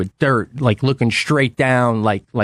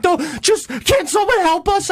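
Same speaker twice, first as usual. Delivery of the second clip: wife panicking. Retold or imitated. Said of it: "Jackie's like".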